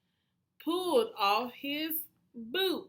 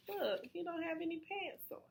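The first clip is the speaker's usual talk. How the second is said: usual speaking voice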